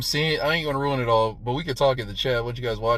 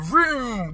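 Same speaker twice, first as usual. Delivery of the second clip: high imitated engine roar; playful saw noise; mock saw vroom; 9 semitones higher than usual. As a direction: make the saw start up with your voice.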